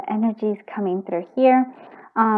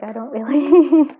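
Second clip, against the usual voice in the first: real tears breaking into a sob-laugh; words wobbling into crying; tearful and slow; 6 semitones higher, 4 dB louder, slower than usual. Real, not acted. Reader overcome by the reading, tearful then sob-laugh. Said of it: "I don't like to tear up".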